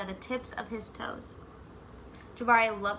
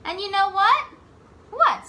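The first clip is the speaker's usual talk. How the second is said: much higher and louder, eager; excited back-and-forth; 9 semitones higher, 9 dB louder than usual. Acted. Jabari and dad trading excited lines. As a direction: keep the excitement bubbling.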